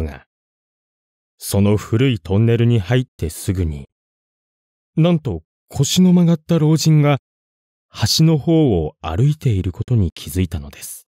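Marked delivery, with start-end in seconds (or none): none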